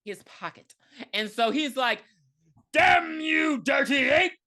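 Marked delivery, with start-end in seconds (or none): 2.7-4.3 high, loud mock outrage; hammed-up furious shout. Recapper imitates the pissed old war vet, mock furious shout. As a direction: shout it as a melodramatic curse. Retells villain's furious curse.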